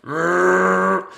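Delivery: long rattling machine drone; held motor-like rrrr; imitated hoover noise. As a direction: rev it like a hoover sucking up marbles. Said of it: "the sound of that machine".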